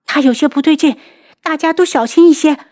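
high, urgent shouted warning; loud warning. A narrator's reading. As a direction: call out to alert everyone. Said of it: "大声道".

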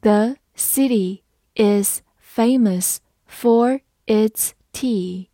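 word by word, spaced out; very slow, deliberate model sentence; slow reading. Shown as a demonstration. Teacher reads the example for learners, slowly word by word. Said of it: "好，慢读一遍".

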